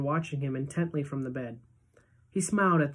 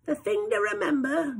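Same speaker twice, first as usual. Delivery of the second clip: put-on high, quavery-free old-lady voice; Grandma Georgina reassuring Charlie, high old-lady voice; grandmother voice; 10 semitones higher than usual. A narrator's reading. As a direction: pitch it way up like a granny.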